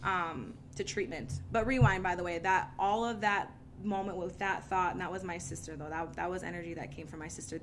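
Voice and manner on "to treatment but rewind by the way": ordinary delivery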